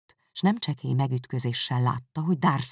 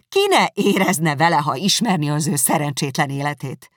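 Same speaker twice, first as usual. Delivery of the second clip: indignant defence; 2 semitones higher, 9 dB louder than usual. Acted. Elizabeth defending Wickham to Darcy, loud and indignant. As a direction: defend him hotly.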